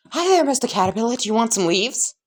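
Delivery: high, eager little voice; chirpy childlike offer. Acted. Izuku offers leaves to his caterpillar teacher, chirpy. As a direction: offer a treat in a high, bouncy voice.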